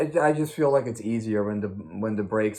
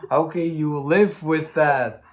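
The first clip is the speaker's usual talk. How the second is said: higher, louder and slow, in disbelief; can't believe anyone puts up with it; incredulous; 6 semitones higher, 7 dB louder than usual. Real, not acted. To a friend who rides that bike daily, incredulous.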